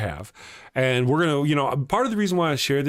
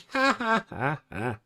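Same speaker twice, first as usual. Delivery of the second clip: hearty laugh trailing off softer; laughter; 3 semitones higher, 4 dB quieter than usual. Real, not acted. Host amused by his own story, laughing. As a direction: burst out laughing, then let it fade.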